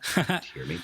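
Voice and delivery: calling out. Retold or imitated. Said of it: "I'm like".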